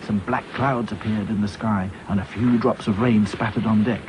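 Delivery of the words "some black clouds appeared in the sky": ordinary delivery, nothing marked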